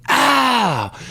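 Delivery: sharp high yelp of pain; a child's sudden cry when hit; retold scream. Son hit by a football, a high yelp. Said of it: "so he screams right away".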